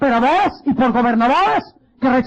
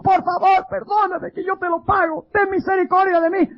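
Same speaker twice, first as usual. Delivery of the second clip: lightly voiced debtor pleading; 9 semitones higher than usual. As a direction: beg on your knees for mercy. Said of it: "se arrodilló y dijo".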